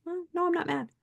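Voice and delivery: high, polite sing-song denial; high sweet denial. Retold or imitated. Replying to coworkers asking if she's mad, high sweet denial. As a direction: play it innocent and high. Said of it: "I say".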